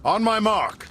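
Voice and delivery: calling out. Acted. Boss signals ambush to his men.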